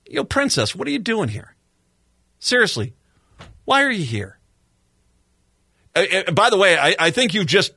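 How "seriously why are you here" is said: ordinary talk, nothing marked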